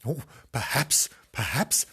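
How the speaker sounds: plain reading voice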